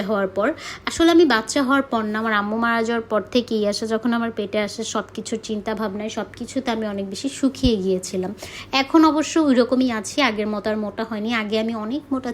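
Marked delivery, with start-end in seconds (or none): none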